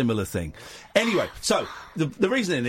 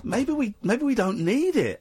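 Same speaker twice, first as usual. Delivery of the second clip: timid, higher, slow tentative voice; meek hesitant suggestion; 6 semitones higher, much slower than usual. Retold or imitated. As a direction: gently suggest it, unsure. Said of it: "me and Kath went".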